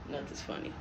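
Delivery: unvoiced hissed whisper. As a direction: whisper it sharply. Hushed retort in car.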